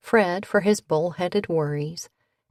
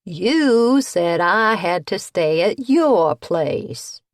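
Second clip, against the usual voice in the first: put-on slow louder teenage whine; 5 dB louder, slower than usual. A narrator's reading. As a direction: whine it like a sulky teen. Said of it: "reverted to a teenage whine".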